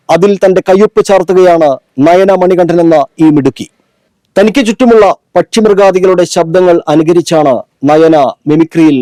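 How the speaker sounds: emphatic reporter read, phrase by phrase; TV news report. A narrator's reading. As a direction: deliver a scripted TV news report with falling phrase ends. TV reporter's scripted report and sign-off. (TV വാർത്താ റിപ്പോർട്ടറുടെ ശൈലി)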